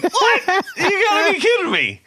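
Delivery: high, loud, laughing disbelief; stunned disbelief. Retold or imitated. Retelling arriving as the band finishes, incredulous laughing squeal. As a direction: squeal it out in mock shock.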